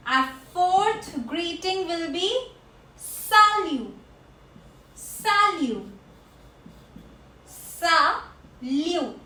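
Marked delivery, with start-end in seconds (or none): 3.0-9.2 salut said sa-lu with no final T; silent T. Shown as a demonstration. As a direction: drop the T in salut. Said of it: "T is silent here".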